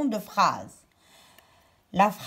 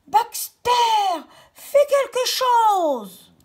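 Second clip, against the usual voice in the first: much higher, louder, slow desperate call; crying out; 10 semitones higher, 6 dB louder, slower than usual. Acted. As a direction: shout for help as the river sweeps her off. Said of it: "Perrine cria".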